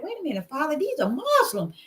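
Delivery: amazed realization. Retold or imitated. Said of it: "I'm like".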